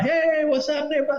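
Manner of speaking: high-pitched, drawn-out mock hello; acted hearty greeting. Undercover agent retells old friends greeting each other, acted hearty greeting. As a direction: greet like a long-lost buddy.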